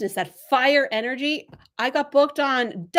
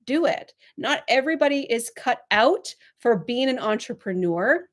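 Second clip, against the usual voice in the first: normal talking voice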